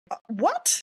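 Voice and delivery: high rising disbelief; baffled. Retold or imitated. Stunned reaction to being cut off.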